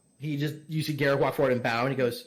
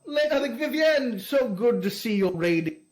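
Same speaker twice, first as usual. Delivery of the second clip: fawning lord greeting; 6 semitones higher than usual. Acted. GM voices lord greeting guest.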